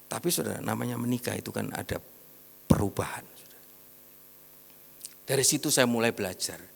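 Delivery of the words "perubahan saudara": breathy whispered word. Real, not acted. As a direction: drop to a hushed confiding whisper. Soft aside in sermon. (berbisik pelan)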